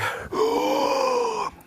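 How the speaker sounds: high, drawn-out demonstration gasp; mock frightened gasp. Shown as a demonstration. Leading children in the gasp, mock fright. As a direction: show the children a big gasp.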